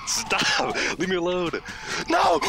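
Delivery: high, loud panicked yelps; panicking. Real, not acted. Streamer begging to be left alone, panicking. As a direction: cry out in a flustered panic. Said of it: "I'm panicking".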